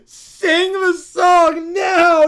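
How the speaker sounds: put-on loud, slow crying kid whine; bratty child. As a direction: wail like a spoiled child. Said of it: "the Brat Pack".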